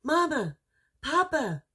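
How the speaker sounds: plain reading voice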